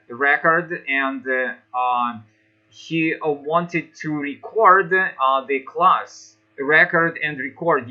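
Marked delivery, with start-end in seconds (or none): 3.3-4.9 record as a verb, stressed second, re-CORD, a little slow. Shown as a demonstration. Teacher giving the verb example, re-CORD slowly. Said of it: "record is a verb". 6.6-7.9 first record REC-ord, second record re-CORD; noun then verb stress. Shown as a demonstration. Teacher contrasting record noun and verb stress. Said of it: "record is a noun and record is a verb".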